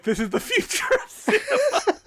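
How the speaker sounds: very high, laughing hype; mock-awed proclamation. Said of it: "and be like".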